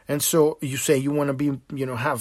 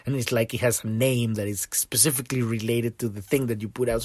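ordinary delivery

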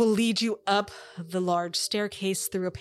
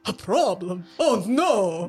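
louder, mock-dramatic alarm; Baron; 8 dB louder, slower than usual. The Baron hearing of a problem, hammed-up alarm. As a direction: gasp it theatrically.

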